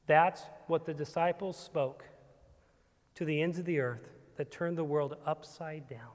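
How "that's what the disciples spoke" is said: ordinary delivery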